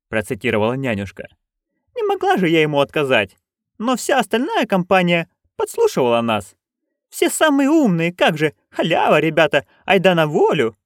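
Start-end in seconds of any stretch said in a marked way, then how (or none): 2.0-10.8 put-on higher, eager old crone; Nanny Ogg voice. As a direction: pitch up into a gleeful old busybody. (высокий азартный голос старой ведьмы)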